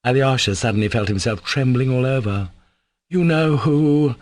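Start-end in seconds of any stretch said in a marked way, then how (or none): 3.1-4.1 slow, higher-pitched, helpless utterance. A narrator's reading. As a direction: let it escape, drawn out. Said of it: "broke helplessly from him".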